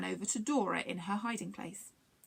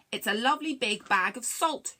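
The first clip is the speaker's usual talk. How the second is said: loud, mild story shout; 2 semitones higher, 5 dB louder than usual. A narrator's reading. Longstint threatens the aliens with salt, shouting. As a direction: announce it loudly, without full yelling. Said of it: "shouted Longstint".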